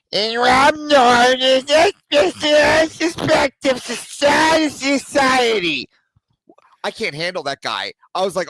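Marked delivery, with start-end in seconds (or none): 0.1-5.9 high, loud, drawn-out strained voice; mocking caricature, every word stretched out at a high pitch; annoying debater. Host imitating the guy he had to mute, annoying debater voice. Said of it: "I had a guy talking like this".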